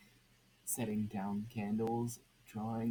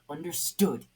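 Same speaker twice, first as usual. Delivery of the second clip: a loud, higher, threatening question; menacing demand; 6 semitones higher, 9 dB louder than usual. Acted. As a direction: bark it at the crowd.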